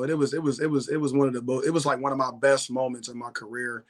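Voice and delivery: normal talking voice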